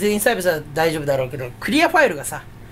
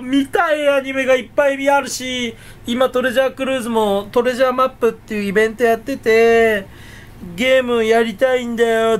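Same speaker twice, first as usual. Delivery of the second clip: hammed-up whiny appeal, starting high; mock pleading; 3 semitones higher than usual. Streamer begs viewers to forgive neglected videos, mock pleading.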